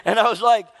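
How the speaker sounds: ordinary talk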